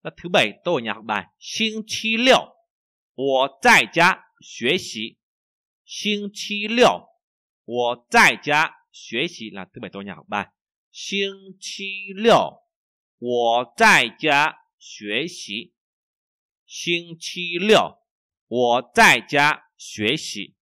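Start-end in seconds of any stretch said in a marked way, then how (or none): 1.4-9.5 slow, loud, carefully spaced Chinese for learners; slow model reading. Shown as a demonstration. Repeats model sentence. 10.9-20.6 slow, loud, carefully spaced Chinese for learners; slow model reading. Shown as a demonstration. Repeats model sentence.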